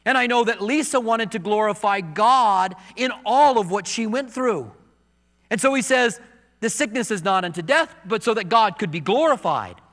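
high, loud sermon delivery; preaching. Acted. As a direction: proclaim it from the pulpit. Pastor preaching opening verses.

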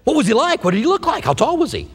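eager and high, pitching up on each question; excited questions. Retold or imitated. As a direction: gush with curiosity about the angel. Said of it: "I would say".

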